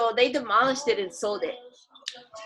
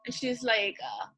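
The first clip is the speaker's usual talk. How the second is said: ordinary talk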